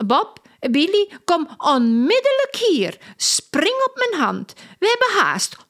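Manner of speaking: high-pitched, eager circus woman; excitable circus lady. A narrator's reading. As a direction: play Lola, breathless with worry. Lola hurries and thanks.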